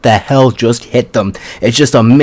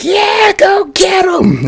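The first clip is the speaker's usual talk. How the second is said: shouted very high, the last words drawn out slowly; wild cheering shout; slower than usual. Retold or imitated. Krista shouting encouragement at the rampaging Ymir: very high, wild shout. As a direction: scream it like a crazed fan at a game. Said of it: "And now she's just like".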